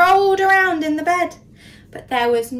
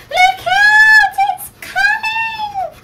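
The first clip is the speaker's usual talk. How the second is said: frightened squeak; 10 dB louder, slower than usual. A narrator's reading. Frightened mice shout warning.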